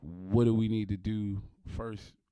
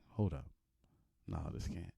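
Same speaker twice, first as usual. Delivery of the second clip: hushed, stunned under the breath; quiet disbelief; 4 semitones lower, 13 dB quieter than usual. Retold or imitated. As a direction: whisper it in shock. Disbelief at purchase going through.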